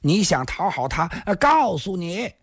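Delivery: put-on high-pitched drunken rant; indignant yelling. A narrator's reading. Drunk youth defying the man ordering him out, yelling. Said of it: "少年叫了起来".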